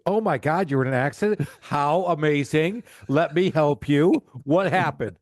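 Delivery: over-the-top delighted receptionist; gushing mock delight. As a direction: play thrilled at a caller's bad news. Mock gushing receptionist hearing of accident.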